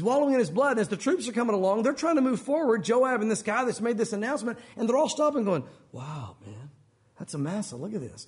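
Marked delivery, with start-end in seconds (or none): 5.9-8.3 low, soft murmur of onlookers; hushed gawking. Acted. Passing troops stopping to gawk at Amasa, hushed. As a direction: take it in under your breath, much lower.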